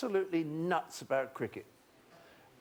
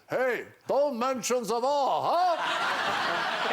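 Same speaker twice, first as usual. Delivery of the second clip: slow, loud mock German shouting; 7 semitones higher, 5 dB louder, much slower than usual. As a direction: bellow it like a jolly German businessman. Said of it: "and he shouts".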